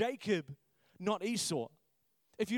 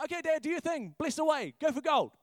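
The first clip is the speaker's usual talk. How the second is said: higher, louder cheerful son urging dad on; eager son; 9 semitones higher, 6 dB louder than usual. Retold or imitated. Joseph urging his father to bless, bright and louder. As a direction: cheer the old man on. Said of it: "Joseph is like".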